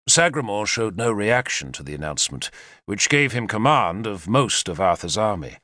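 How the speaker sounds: ordinary delivery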